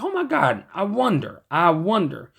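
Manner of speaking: high-pitched retold gasp of realization; wondering excitement. Reviewer retelling how he came across a spare band, excited wonder. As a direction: relive a sudden bright idea, starting high.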